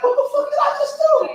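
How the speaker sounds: high mock panic from someone waking up after a wild drunk night; mock panicked. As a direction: play the horrified morning-after self. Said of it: "because you was like".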